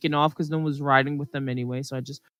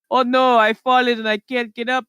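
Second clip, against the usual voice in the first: a badly done put-on voice, a little higher; terrible impersonation; 6 semitones higher than usual. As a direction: ham up a cheesy old TV ad line. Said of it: "these really terrible impersonations".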